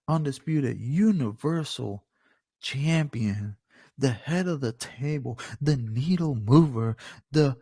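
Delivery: grand, drawn-out list of titles; ring announcer. Pretend. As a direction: punch each title slowly like a ring announcer. Performs mock ring announcer introduction.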